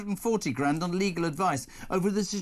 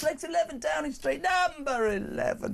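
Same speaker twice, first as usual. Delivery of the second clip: bingo caller; 9 semitones higher than usual. Shown as a demonstration. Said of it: "I could call bingo like nobody".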